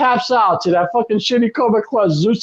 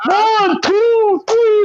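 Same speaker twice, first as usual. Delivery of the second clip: much higher, drawn-out referee count with Italian-sounding vowels; imitated referee count; 11 semitones higher, much slower than usual. Recreating the main event's pinfall, mock referee count. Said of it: "sound like the referee was Italian".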